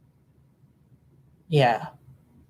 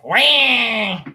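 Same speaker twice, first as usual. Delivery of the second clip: long, high imitated whine; high-pitched wail as a sound effect; imitated "wah" noise; 7 semitones higher than usual. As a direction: mimic a drawn-out, high "waah". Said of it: "they were like".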